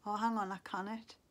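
ordinary delivery

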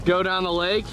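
ordinary talk

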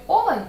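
олень with the first О stressed high and loud. Shown as a demonstration. Teacher marks the stressed vowel. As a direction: hit the О in олень. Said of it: "в слові".

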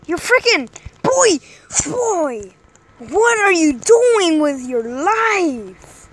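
loud, slow, exasperated; frustrated yelling. Real, not acted. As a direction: yell at him in disbelief. Yelling at camping player.

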